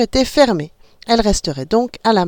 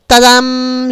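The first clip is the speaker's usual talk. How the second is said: loud showy ta-da; mock fanfare; 5 semitones higher, 6 dB louder than usual. A narrator's reading. Mother announcing the draw with a fanfare. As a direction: build suspense for the draw. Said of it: "Elle déclara".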